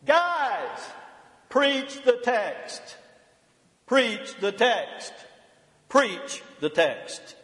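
high, slow New Jersey shout fading down; a retold professor's New Jersey accent, starting high. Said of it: "in his heavy New Jersey accent".

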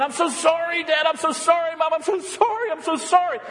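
high-pitched fake-tearful apology, over and over; mock crying. Kid faking repentance to Mom and Dad, mock crying. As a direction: wail it like a kid faking tears. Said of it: "as I was crying and crying".

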